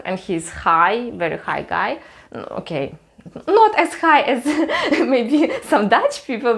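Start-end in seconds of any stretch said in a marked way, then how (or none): 3.5-6.5 higher, giggling through the words; laughing. Real, not acted. Joking about tall Dutch people, laughing. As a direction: let a laugh break in.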